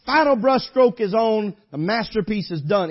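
loud, high hellfire preaching; preaching at full force. Acted. Preacher driving the point home to the congregation, loud and high. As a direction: thunder it out from the pulpit.

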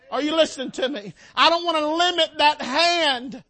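loud, rising sermon climax; preacher urging the congregation toward the climax; full-force preaching. Real, not acted. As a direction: build to the peak of the sermon.

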